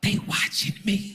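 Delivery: high, slow whispered worry; nervous date whisper. Retold or imitated. Date fears being watched, high slow whisper. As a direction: whisper it like she's scared.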